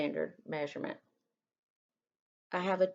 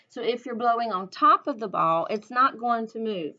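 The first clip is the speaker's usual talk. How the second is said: repeated louder so they hear; 3 semitones higher, 6 dB louder than usual. Real, not acted. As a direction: raise your voice to carry. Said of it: "I don't know if you heard me".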